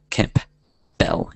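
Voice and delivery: "Kemp. Bell" split by a pause; syllable by syllable. Shown as a demonstration. Host spelling out their surname for listeners, syllable by syllable. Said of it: "wondering how to spell".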